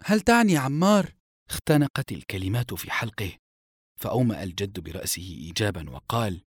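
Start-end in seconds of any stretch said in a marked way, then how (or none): 0.0-1.1 high, loud amazed question; young guard's acted astonishment; astonished question. A narrator's reading. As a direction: ask in disbelief, voice lifted. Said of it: "واتسعت عيناه ذهولا".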